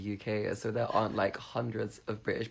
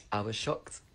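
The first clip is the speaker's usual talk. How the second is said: usual speaking voice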